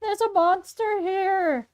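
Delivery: high, slow, drawn-out call; called-out warning. Acted. Fleeing trio warning people of a monster, calling out. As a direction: call out an alarm to everyone around. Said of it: "They start calling out a warning".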